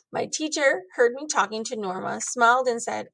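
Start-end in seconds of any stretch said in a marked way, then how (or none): none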